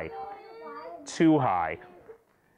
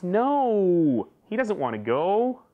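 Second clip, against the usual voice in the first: put-on child's upset protest; 6 semitones higher, 4 dB louder, slower than usual. Child pleading for cat.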